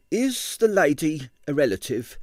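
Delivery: a hint of a genteel landlady asking; genteel landlady, lightly voiced; prim landlady. A narrator's reading. Landlady voiced in read novel.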